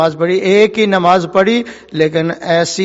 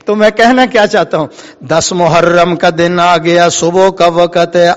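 usual speaking voice

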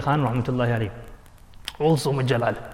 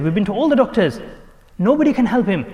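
desperate; 6 semitones higher, 4 dB louder than usual. Retold or imitated. Acted reply of desperate son.

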